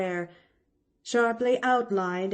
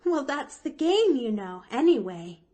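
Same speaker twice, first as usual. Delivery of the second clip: put-on higher, louder little-girl voice; lightly amused Pollyanna; 7 semitones higher, 6 dB louder than usual. A narrator's reading. Pollyanna explains her game to Nancy, lightly amused. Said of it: "Pollyanna laughed softly".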